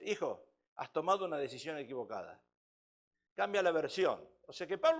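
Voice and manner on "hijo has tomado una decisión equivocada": gentle correction. Acted. Parent correcting child gently.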